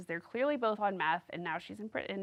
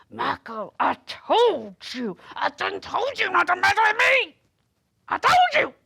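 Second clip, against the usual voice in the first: Sarah impression; 11 semitones higher, 9 dB louder than usual. Imitating Sarah yelling at Michael, high hammed-up tough-girl voice. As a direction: go from a scold to a rising high yell at Michael. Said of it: "I do a great Sarah impression".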